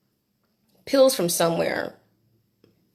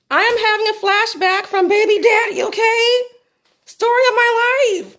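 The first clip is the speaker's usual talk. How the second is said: high, loud exasperated cry; exasperated outburst; 12 semitones higher, 5 dB louder than usual. Real, not acted. Telling viewers about her flashback, loud and exasperated. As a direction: blurt it out in disbelief. Flashback to baby daddy exclaimed.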